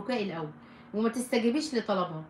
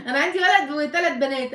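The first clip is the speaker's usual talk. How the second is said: high, amused laugh running into speech; real laughter; 6 semitones higher, 4 dB louder than usual. Real, not acted. Host telling a viewer about her four children, laughing. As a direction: answer through a real laugh, pitched up.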